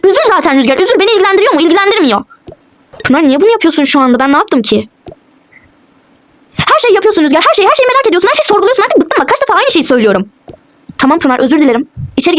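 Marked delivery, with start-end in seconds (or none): 0.0-2.2 high-pitched, cross dismissal; scornful. Acted. Wife dismissing husband's hurt feelings, scornful. 6.6-10.3 very high-pitched, exasperated tirade; angry. Acted. Wife accusing husband of prying into everything, angry tirade. Staged quarrel, peak of accusations.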